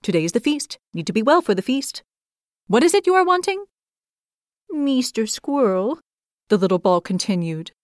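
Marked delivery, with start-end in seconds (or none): 0.0-3.6 busy squirrel rushing along; a light hurried squirrel voice, rising at the end. A narrator's reading. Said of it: "The squirrel hurried along". 4.7-6.0 small, higher, slow, meek creature voice; a shy little hedgehog pleading slowly; timid hedgehog voice. A narrator's reading.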